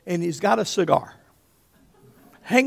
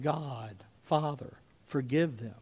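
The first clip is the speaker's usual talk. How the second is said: soft, lowered plea to the Father; quiet prayer, no yelling; 5 semitones lower, 11 dB quieter than usual. Acted. Jesus on the cross asking forgiveness, quiet prayer. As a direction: pray it gently and low. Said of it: "he's not yelling out in pain".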